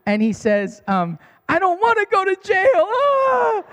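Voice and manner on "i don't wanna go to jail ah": very high, loud mock wail of a child; hysterical crying. Son terrified of jail, imitated hysterical wail. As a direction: imitate a kid bawling, ending in a long high cry. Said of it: "he starts crying hysterically".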